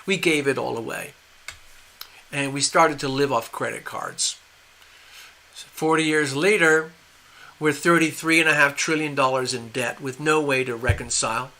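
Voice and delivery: ordinary talk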